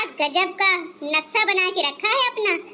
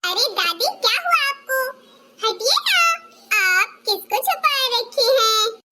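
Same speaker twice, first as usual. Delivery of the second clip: put-on little child voice; 6 semitones higher than usual. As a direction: play a small child giggling at aunty's short dress. Child questions grandma. (छोटे बच्चे की ऊँची आवाज़)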